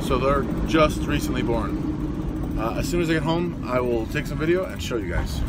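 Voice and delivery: loud, eager news of new piglets; excited. Real, not acted. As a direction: burst with excitement, talk loud. Rushing home excited, piglets born.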